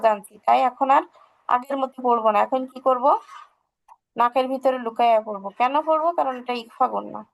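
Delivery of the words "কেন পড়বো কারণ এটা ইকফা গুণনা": ordinary delivery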